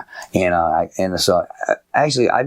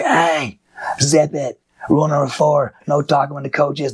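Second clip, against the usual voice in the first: higher-pitched, curt call to order; coach's reprimand; 6 semitones higher than usual. Retold or imitated. Coach stopping camp over talking parents, sternly. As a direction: cut in on the talkers like a camp coach. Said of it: "I'll just stop the whole camp".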